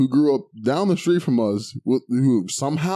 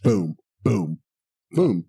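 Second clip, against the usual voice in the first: ordinary talk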